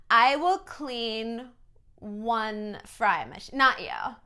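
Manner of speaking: put-on slow, stretched Valley Girl condescension; mock Valley Girl. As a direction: parody the Valley Girl with drawn-out words. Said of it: "this weird Valley Girl accent".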